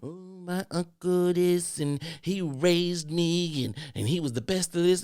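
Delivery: high, slow mock-mournful pleading; hammed-up sad excuse; mock sob story. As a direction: parody a grieving excuse. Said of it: "sad sob stories".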